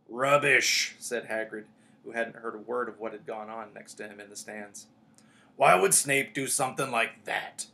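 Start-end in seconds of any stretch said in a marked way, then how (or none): 0.1-1.0 booming, dismissive Hagrid; loud dismissal. A narrator's reading. Hagrid flatly rejects Ron's accusation. 5.6-7.7 booming, disbelieving challenge; loud disbelief. A narrator's reading. Hagrid disputes the accusation.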